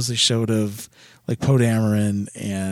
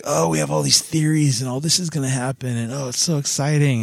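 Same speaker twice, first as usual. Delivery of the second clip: higher-pitched imitation of hyped fans; mock excitement; 5 semitones higher than usual. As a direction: lightly parody an overexcited fan. Mocking fans' hype and theories.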